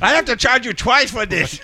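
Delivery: put-on high caricatured Chinese accent; hammed-up, high Chinese clinic man; mock Chinese accent. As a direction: complain in a fake Chinese accent. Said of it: "the Chinese guy was like".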